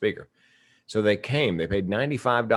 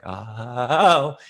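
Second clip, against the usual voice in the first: long rising, louder "oh"; dawning realization; 5 semitones higher, 5 dB louder than usual. Retold or imitated. Listener grasping his superpower from a book, acted realization. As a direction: act out the penny dropping, swelling as it goes. Acts out sudden realization about vulnerability.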